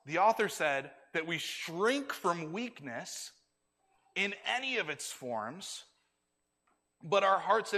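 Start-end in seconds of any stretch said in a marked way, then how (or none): none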